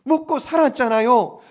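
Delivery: ordinary delivery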